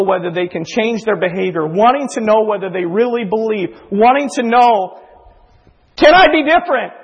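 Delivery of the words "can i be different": anguished cry. Retold or imitated. Anguished question of the struggler.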